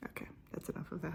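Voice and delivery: quick whispered aside. Real, not acted. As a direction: whisper it fast to yourself. Ending skin close-up.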